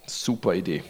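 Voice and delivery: dry, low sarcastic aside. Real, not acted. Preacher mocking the prophet's advice, sarcastic. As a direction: drop it low and mock the idea.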